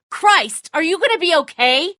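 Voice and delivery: high, loud alarmed call; frantic cry. Acted. Father panicking over his wounded son, frantic cry. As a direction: panic for your hurt son.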